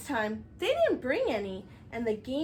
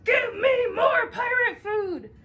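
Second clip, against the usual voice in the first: louder, higher and slow demanding yell; greedy shout; 9 semitones higher, 8 dB louder than usual. Acted. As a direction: bellow it like a greedy little pirate. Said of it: "he'd forever be shouting".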